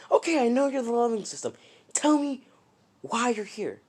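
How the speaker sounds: high, quick exasperation; impatient demand. Retold or imitated. Deku pressing the leveling system for answers, impatient.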